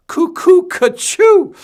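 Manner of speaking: very high, slow, loud squeal; silly squeaky voice. Pretend. Reading a silly sticker name.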